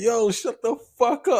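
high yelped mock rant; imitating tweaking man. Man yelling into train intercom, high mock rant. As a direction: pitch way up like the crazy guy. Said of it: "he was like".

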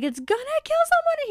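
panicked Harry. Acted. Harry chasing the voice, frantic and high-pitched. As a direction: blurt it out in a rush of panic. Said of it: "he's like".

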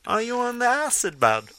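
high playful Liverpool Beatle voice; [name] Scouse accent. Pretend. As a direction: spin a yarn like a Beatle. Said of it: "like a Beatle".

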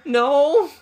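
high, loud cry of refusal; dismayed yell. Real, not acted. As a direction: yell it in dread at the game. New enemy appears.

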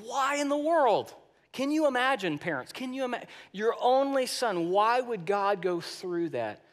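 high, incredulous rhetorical questions; impassioned disbelief. Real, not acted. Preacher asks parents about giving an only son, incredulous. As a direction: press the question on them in disbelief.

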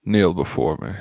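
low, soft creepy command; menacing cult leader, low and quiet; slow theatrical villain menace. Acted. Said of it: "Oh, this is so creepy".

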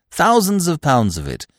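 ordinary delivery, nothing marked

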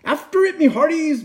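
high, urgent pirate call; captain's command. A narrator's reading. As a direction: send the crew after it. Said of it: "Braidbeard commanded".

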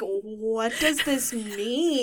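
puzzled. Retold or imitated. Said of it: "And I'm like".

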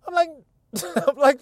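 laughs through the words; can't hold back a laugh. Real, not acted. Laughing while retelling the sales call.

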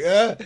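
a loud, high lurching uh; startled grunt. Retold or imitated. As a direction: stumble and grunt in surprise. Nearly falling over.

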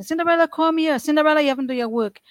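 high, scolding call of the mother. Retold or imitated. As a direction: bark it like a harsh mother summoning a child. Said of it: "she even turned my name to Cinderella".

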